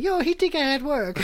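mock angry wife. Little wife voiced in story.

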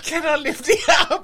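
laughing quote. Acted.

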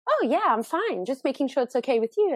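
a hint of a careful young boy; imitating boy. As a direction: lightly play the polite son reassuring mum. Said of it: "He says".

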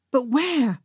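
panicked. A narrator's reading. Narrator looking for a place to hide, panicked. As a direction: ask it in rising panic, pitch up. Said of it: "I panicked".

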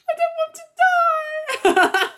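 melodramatic Cassandra. Retold or imitated. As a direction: ham up a dying diva through giggles. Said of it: "She's so dramatic".

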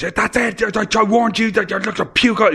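very high, mock-furious ranting; hammed-up screechy tirade; going bananas. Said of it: "this is going bananas, like, slamming doors".

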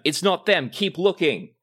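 loud, high frustrated guard call; a guard calling off the false find; guard shouting. Acted. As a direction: shout the order on. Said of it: "And then the sound".

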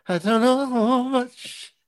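high, slow crooning voice; slow high soulful croon. Pretend. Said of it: "in the voice of [name]".

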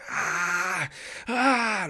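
high, restrained scream; mock scream. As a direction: imitate screaming without full volume. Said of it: "I started screaming".

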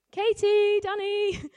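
loud shout across the maze; calling out. Retold or imitated. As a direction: yell their names. Shouting for lost girls.

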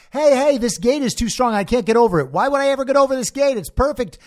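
hammed-up shouted reply; mock scream back. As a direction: yell back as if from far behind. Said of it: "Ricky starts screaming back".

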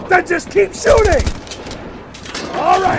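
loud triumphant yelling; excited shout. Acted. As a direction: yell it in triumph. Battle turns, shouting.

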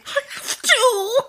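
high, slow, whiny mock crying; hammed-up anguish over a pumpkin latte; mock wail. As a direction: pretend sob of defeat. Mock outrage, can't resist responding.

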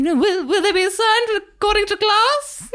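very high, slow, fussy matron voice; hammed-up snobbish falsetto; Rose's mum. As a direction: fret grandly in a high put-on voice. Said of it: "you'd be Rose's mum".

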